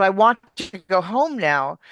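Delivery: "I want to go home now" with lively swoops in pitch; attention-grabbing intonation. Shown as a demonstration. Coach shows how to hold attention, lively "I want to go home now". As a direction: grab the listener with the tune of "I want to go home now". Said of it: "to grab the person's attention".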